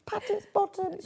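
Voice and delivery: cooing. Pretend. Pretending to settle the bear.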